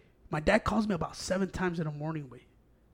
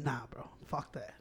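low, very soft dismissal; muted self-refusal; 7 semitones lower, 15 dB quieter, slower than usual. Retold or imitated. Telling friends he refused the cookies, low and quiet. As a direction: turn it down under the breath.

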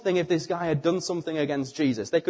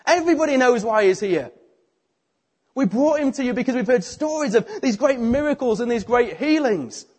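higher and louder, exasperated; frustrated friends, voices raised; baffled protest; 8 semitones higher, 6 dB louder than usual. Acted. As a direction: plead with Jesus as if he has missed the point. Confused friends protest to Jesus.

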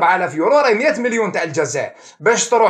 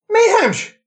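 outraged exclamation; 9 semitones higher than usual. Real, not acted. Outraged about airline indifference.

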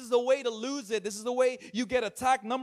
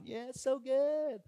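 drawn-out, higher, gushing encouragement; mock cheering on; 4 semitones higher, slower than usual. People cheering on someone's dream, mock gushing. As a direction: play the overly supportive friend, slowly. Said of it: "people are cheering you on".

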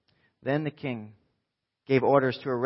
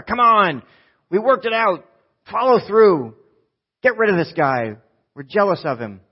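loud, high, insistent demands; pushy demands; 8 semitones higher, 10 dB louder than usual. Acted. Jealous officials loudly pressuring the king. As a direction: press the king hard, raising your voice.

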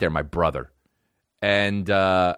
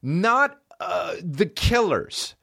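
much higher, slow, outraged mock rant; indignant rant; 9 semitones higher, slower than usual. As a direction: rant like an insulted artist, drawing out the band names. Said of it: "And I'm like".

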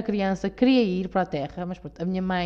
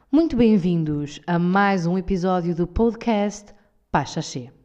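slow, formal podcast welcome; institutional voice; 4 dB louder, slower than usual. Pretend. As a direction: announce it like an official broadcast. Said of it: "Muito institucional". (voz institucional, lenta e formal)

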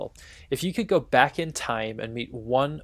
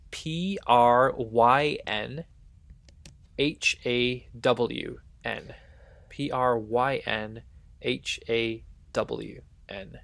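letters spelled out one by one, slow; slow letter-by-letter spelling; spelling out slowly; much slower than usual. Shown as a demonstration. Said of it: "I have a Welsh word for you".

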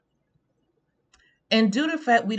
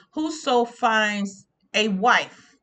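slow scripture quote; 2 semitones higher, 5 dB louder, much slower than usual. Acted. Reciting scripture slowly.

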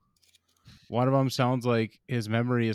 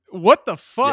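high loud disbelief; exasperated shout; 10 semitones higher, 13 dB louder, slower than usual. Retold or imitated. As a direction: blurt it out in disbelief. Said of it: "I'm like".

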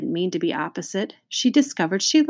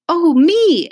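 very high, loud squeal; eager exclamation; 13 semitones higher, 10 dB louder than usual. A narrator's reading. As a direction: jump in loudly, begging to be picked. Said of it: "exclaimed Joy".

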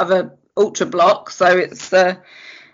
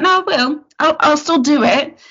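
stubborn insistence; 6 semitones higher than usual. Retold or imitated.

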